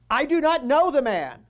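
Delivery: loud, high, forceful denial; angry denial. Acted. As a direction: shout it like a cornered man.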